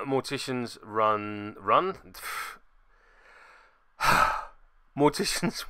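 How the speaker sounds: usual speaking voice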